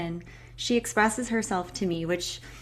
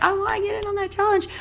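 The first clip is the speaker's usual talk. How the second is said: high, fast, excited imitation of the group; high eager mock voice; eager quote; 12 semitones higher, faster than usual. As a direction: squeal it like an eager participant. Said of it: "a lot of you are like".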